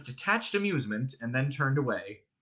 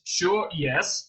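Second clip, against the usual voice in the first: slightly raised, placating cut-in; Ford hurriedly interrupting; 4 semitones higher, 3 dB louder than usual. A narrator's reading. Prisoner cutting off a bellowing guard, hurriedly. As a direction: jump in to stop the shouting. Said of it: "interrupted Ford hurriedly".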